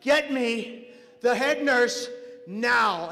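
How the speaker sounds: very slow, forceful order; angry demand. Acted. Dad demands the head nurse, slow forceful order. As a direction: space out each word with rising force.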